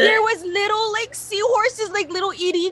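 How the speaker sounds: high, crying voice breaking through; upset, near tears. Retold or imitated. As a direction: let the tears catch in the words. Finding dead seahorses in backpack.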